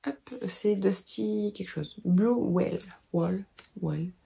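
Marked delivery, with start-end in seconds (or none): 2.1-4.1 fumbling English accent on "Blue Well, Wall, Well"; English accent attempt. Real, not acted. Knitter reads English yarn colour name in halting English accent. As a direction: try an English accent reading the yarn label. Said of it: "mon accent anglais".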